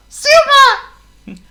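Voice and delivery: very high squeaky delight; excited mouse. A narrator's reading. Mouse sees the airplane, high excited squeak. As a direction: squeak with joy.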